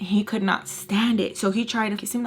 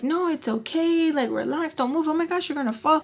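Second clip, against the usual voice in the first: fussing aunts; 7 semitones higher than usual. Retold or imitated. Aunts fussing over Ray.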